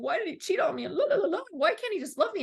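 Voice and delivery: high, sing-song whining with a laugh; whiny self-mockery. Mocking her past self whining about cheating men, in a high whiny voice.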